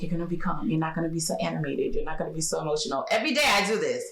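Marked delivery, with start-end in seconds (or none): 0.0-3.1 softer, lower pep talk to herself; calm self-talk. Retold or imitated. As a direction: coax yourself to stay calm, quietly. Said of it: "I'm like".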